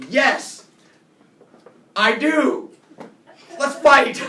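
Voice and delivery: loud, high blurted yes; forced bravado. Retold or imitated. Flustered kid agreeing to a fight, blurted loud. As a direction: blurt it out bravely without thinking.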